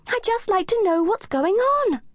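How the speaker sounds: high playful cat voice; a curious kitten speaking up; young cat voice. A narrator's reading.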